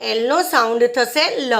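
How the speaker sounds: normal talking voice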